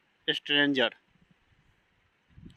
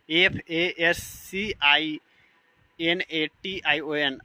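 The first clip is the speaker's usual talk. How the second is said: slow letter-by-letter spelling; slower than usual. Shown as a demonstration. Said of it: "teacher spells out fascination".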